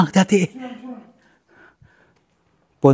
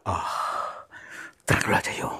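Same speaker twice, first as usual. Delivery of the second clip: whisper; 7 semitones lower, 12 dB quieter than usual. Real, not acted. Calming the spirit softly.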